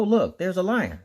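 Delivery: ordinary delivery, nothing marked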